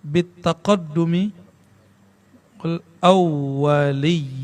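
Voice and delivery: bitaqaddumi slow, the qaddu stressed; careful recitation. Shown as a demonstration. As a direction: spell out bitaqaddumi slowly. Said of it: "Taqaddum, ya".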